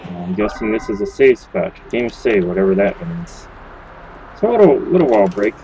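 usual speaking voice

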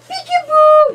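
loud, high peekaboo; excited peekaboo. Real, not acted. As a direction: burst it out with delight. Peekaboo game.

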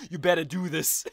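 a light New York tough-guy push; lightly voiced New York FBI agent; New York accent. FBI agent ordering, light mock New York accent. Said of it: "the FBI agent's like".

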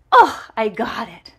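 loud happy got it; excited. Real, not acted. Clipping a nail, loud excited relief. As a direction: burst out pleased.

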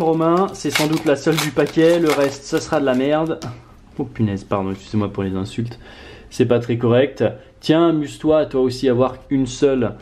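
ordinary talk, nothing marked

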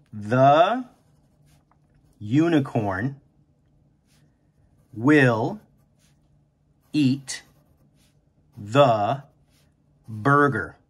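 each word said slowly apart, long pauses; slow teacher dictation, word by word. Shown as a demonstration. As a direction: count out the sentence one word at a time. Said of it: "I'm gonna draw lines for each word".

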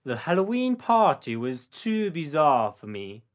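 slow, careful read for repeating; each word laid out slowly for the learner; slow model sentence. Shown as a demonstration. Teacher to student, slow model sentence for repetition. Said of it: "te toca a ti decir esta oración después de mí".

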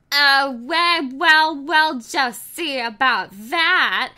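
loud mock-tough comeback; defiant retort. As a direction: call her bluff.

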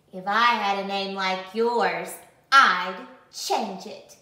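a snide classmate's jab; taunting. Acted. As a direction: rub it in smugly. Classmate taunting her name.

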